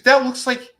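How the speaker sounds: high mock alarmed voice. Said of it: "She goes".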